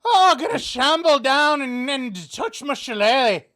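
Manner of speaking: high, loud hammed-up stage Irish accent; mock Irish accent. Joking about the Irish-accent skit, in a loud mock Irish accent. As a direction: belt it out like a cartoon Irishman. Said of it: "Curly to do his full Irish accent".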